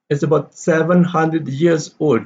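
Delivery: put-on SEVEN pitched high, "old" left unstressed; stress on SEVEN. Shown as a demonstration. Teacher answering Dave's question, stress on SEVEN. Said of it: "seven is, uh, stress here".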